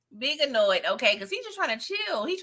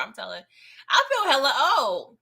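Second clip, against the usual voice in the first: ordinary talk, nothing marked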